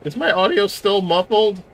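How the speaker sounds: high, loud question with a cracking voice. Real, not acted. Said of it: "your voice is still cracking".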